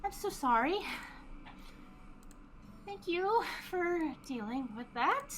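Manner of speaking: high, deflated character voice; apologetic innkeeper. Acted. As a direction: apologize after letting out a sigh. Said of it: "lets out a sigh and her ears flop down".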